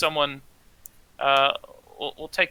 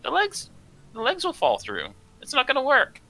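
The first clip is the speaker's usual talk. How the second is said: high, insistent gnome voice; 10 semitones higher than usual. Acted. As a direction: squeak out the warning insistently. Ula keeps arguing in character.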